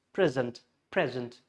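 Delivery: present, front-stressed like the noun; stress on first syllable. Shown as a demonstration. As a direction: present as PREZ-ent. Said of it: "Stress on the P R E".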